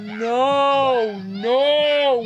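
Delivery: despairing wail. Real, not acted. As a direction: moan a chain of no's, fading from panic into despair. Long despairing wail when stuck.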